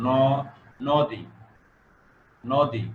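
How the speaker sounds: ন as "no", then নদী as "nodi"; correct pronunciation. Shown as a demonstration. Teacher drilling নদী for students with an ও sound. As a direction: isolate the rounded first syllable, then say নদী whole. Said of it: "নয়ের পর ও".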